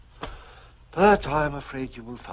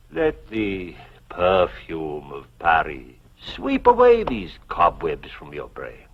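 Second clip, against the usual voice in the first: normal talking voice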